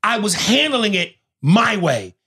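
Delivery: high, emphatic protest; retold protest. Daughter telling her father off, protesting. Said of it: "And she says to him".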